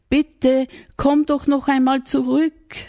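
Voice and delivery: high, loud call after someone leaving. Acted. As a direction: call him back across the field. Maria calls the shepherd back again.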